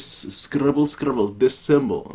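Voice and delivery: ordinary talk, nothing marked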